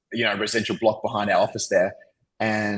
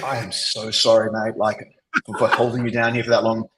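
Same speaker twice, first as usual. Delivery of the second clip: normal talking voice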